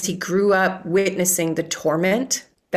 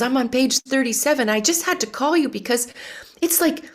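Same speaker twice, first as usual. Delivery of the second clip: high-pitched imitation of an amazed mom; surprised mother; 9 semitones higher than usual. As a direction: gush in mock astonishment, stretching the praise. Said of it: "I don't mean to sound surprised".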